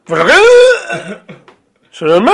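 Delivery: loud yelled protest, last syllable stretched; mock-outraged yell; comic shout. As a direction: shout it out and hold the ending. Shouted comic exchange of catchphrases.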